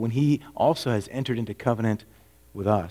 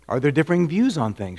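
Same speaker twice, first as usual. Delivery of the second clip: ordinary delivery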